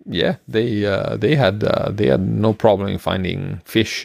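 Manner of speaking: ordinary delivery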